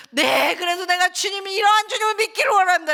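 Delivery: high, loud declaration of faith; fervent resolve. Retold or imitated. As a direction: cry out the decision to believe. Acted decision of faith. (높고 크게 외치는 믿음의 결단)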